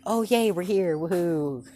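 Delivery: forced high, loud cheer; fake cheerful. Deku faking joy at arriving home, forced loud cheer. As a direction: pretend to be thrilled, high and loud. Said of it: "he's trying to act all cheerful".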